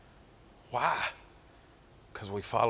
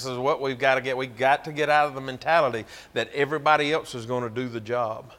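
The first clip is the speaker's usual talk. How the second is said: usual speaking voice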